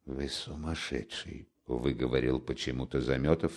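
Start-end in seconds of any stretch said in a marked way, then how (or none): 0.0-1.5 very soft, low and hushed; hushed, barely voiced reply; near whisper. A narrator's reading. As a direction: say it almost in a whisper. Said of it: "тоже чуть не шёпотом".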